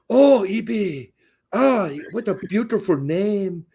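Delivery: high, hammed-up Jamaican that sounds French; Jamaican accent gone French. Local man wooing the co-host's wife, French-sounding Jamaican accent. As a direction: croon it in a botched Jamaican accent. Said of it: "You're doing a French accent".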